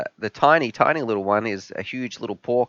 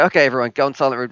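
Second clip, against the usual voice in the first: hurried instruction; 4 semitones higher, 3 dB louder, faster than usual. Retold or imitated.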